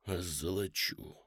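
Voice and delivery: тихо, низко, протяжно; выдохнуть медленно, будто в беспамятстве; бессознательное бормотание. A narrator's reading. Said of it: "сам не понимая того, что говорит". (приглушённый страстный выдох)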